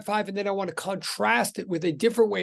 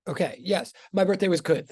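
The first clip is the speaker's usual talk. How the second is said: normal talking voice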